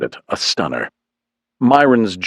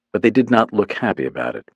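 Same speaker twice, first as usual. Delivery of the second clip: ordinary delivery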